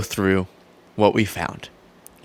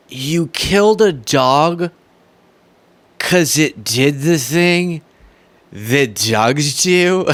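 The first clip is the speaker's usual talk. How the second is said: incredulous; much slower than usual. Real, not acted. Disbelief at the story.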